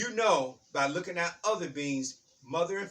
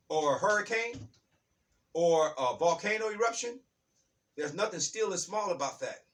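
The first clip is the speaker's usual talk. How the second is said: ordinary delivery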